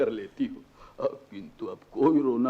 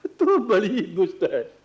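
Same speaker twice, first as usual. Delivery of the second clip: louder, higher, playful scolding with a laugh; father teases daughter for hiding the good news, delighted; 6 semitones higher, 5 dB louder than usual. Acted.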